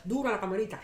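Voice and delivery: ordinary talk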